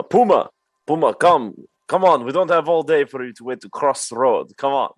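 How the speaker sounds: loud calling to a pet across the road. Acted. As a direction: shout for Puma to come over. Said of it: "audibly start calling, uh, to Puma".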